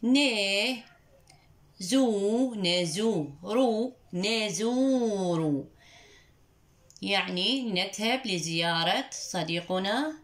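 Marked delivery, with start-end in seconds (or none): 0.0-5.6 very slow يقول with each syllable drawn out; slow, careful reading; slow teaching voice. Shown as a demonstration. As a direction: spell يقول out slowly for the class. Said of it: "لنردد معا".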